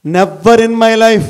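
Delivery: slow and loud, ringing out; loud slow declaration. Shown as a demonstration. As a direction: proclaim "Never in my life" to the hall. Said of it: "repeated motto".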